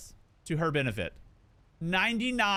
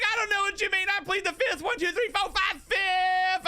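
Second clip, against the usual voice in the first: high, cheeky mock innocence; playing innocent; 12 semitones higher than usual. Husband dodging blame, high cheeky innocence. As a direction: squeak it out like a guilty kid. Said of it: "I was like".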